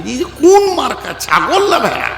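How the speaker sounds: high, scornful taunt; preacher ridiculing beardless boys with a high jeer; mocking jeer. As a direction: sneer at them as if pointing. Mocking clean-shaven young men.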